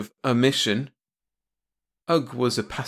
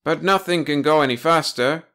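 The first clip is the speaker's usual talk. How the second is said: louder, higher objection; protesting; 6 semitones higher, 5 dB louder than usual. A narrator's reading. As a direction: push back against the captain. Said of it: "protested Ugg".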